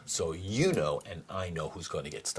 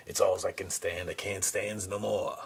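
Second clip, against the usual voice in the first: Popeye impression; 3 semitones higher, 4 dB louder than usual. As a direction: growl it out like Popeye at his limit. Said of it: "As that famed philosopher Popeye said".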